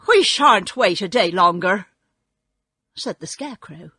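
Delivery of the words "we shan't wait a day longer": loud, firm protest; indignant refusal. A narrator's reading. Scarecrow refusing to wait for Oz, loud and indignant. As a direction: refuse loudly.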